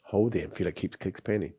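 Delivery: soft, calm breathing instruction; guided breathing. Acted. Guides breathing exercise, soft and slow.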